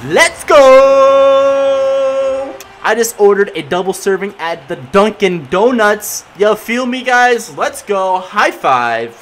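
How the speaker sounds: loud, high celebration; victory shout. Real, not acted. Gamer celebrating his own goal to viewers, loud victory shout. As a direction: hype it up, full volume.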